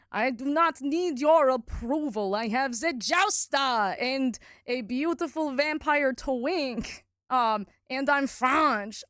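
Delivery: a cat in a bad French accent, grandly dismissing approval; mock French accent. The French cat brushing off the player in a mock French accent, shouting the last words. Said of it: "this is not my actual French accent".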